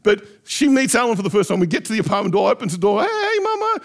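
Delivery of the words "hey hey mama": sing-out "hey" to mum, much higher; excited greeting. Retold or imitated. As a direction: re-enact a delighted doorstep greeting, pitched way up. Calling greeting as door opens.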